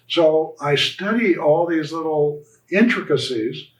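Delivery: normal talking voice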